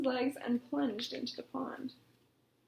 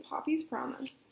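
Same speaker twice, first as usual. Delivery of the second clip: plain reading voice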